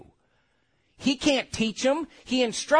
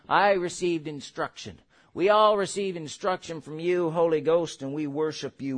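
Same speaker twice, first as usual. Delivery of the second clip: closing prayer; 5 semitones lower than usual. Acted.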